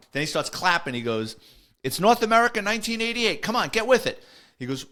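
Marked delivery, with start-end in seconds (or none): 1.8-4.2 high, loud mock scolding; impatient sergeant. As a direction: hurry the rookie along as an exasperated sergeant. Sergeant hurries rookie, snapping and clapping.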